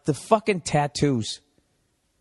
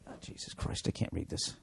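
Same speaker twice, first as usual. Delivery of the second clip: whispered aside; 16 dB quieter than usual. Real, not acted. Muttering aside while reading.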